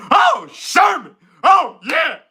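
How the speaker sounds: high, loud comic whooping; Eddie Murphy. Acted. Impressionist channelling Eddie Murphy, high exuberant yells. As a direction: shout it way up high in bursts. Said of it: "like Eddie Murphy".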